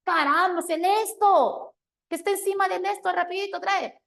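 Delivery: exasperated mom scolding her son, high and loud; annoyed mother. Retold or imitated. Mother impatiently repeats her order.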